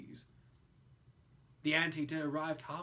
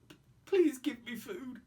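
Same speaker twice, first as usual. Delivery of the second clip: crying creature; 5 semitones higher, 4 dB louder than usual. Acted. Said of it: "the creature cried".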